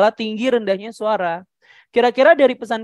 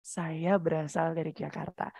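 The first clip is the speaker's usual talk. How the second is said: lopsided pitch drop; 3 semitones higher than usual. Shown as a demonstration. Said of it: "Jangan sampai nada sebelumnya terlalu tinggi".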